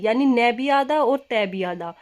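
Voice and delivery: usual speaking voice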